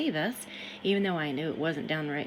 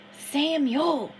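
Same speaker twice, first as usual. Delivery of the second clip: higher, louder call of Samuel; 7 semitones higher, 6 dB louder than usual. Acted. Harrison calls Samuel from the river, loud and high. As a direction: call the name out across the water, loud and high. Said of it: "he hissed loudly".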